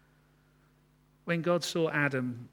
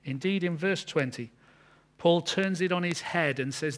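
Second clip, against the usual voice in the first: usual speaking voice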